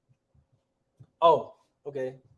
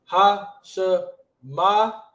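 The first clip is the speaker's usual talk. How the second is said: separate, drawn-out syllables; 3 semitones higher, 4 dB louder, much slower than usual. Shown as a demonstration. Teacher sounding out the word as he writes, syllable by syllable. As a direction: sound it out piece by piece.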